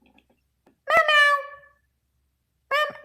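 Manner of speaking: high mewing cat voice; meowing. Pretend. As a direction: mew like a little drowning cat. Voicing the cat on the mug.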